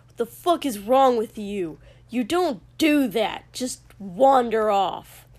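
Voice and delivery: loud, forceful telling-off; furious scolding. A narrator's reading. Furious man stomping towards the one who wandered off, scolding. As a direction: lay into them angrily. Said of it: "looking utterly furious".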